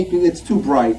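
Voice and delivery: usual speaking voice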